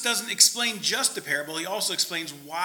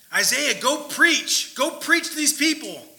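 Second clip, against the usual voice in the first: higher, louder, slow command; commanding God; 7 semitones higher, 5 dB louder than usual. Acted. The Lord sending Isaiah, a slow loud command. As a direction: order him out with weight.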